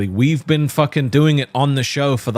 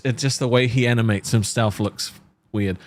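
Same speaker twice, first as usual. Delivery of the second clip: normal talking voice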